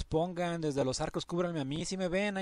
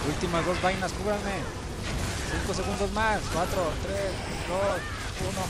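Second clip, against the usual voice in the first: urgent callout; 4 semitones higher than usual. Real, not acted. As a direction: call it out to the squad. Urgent countdown calling to team.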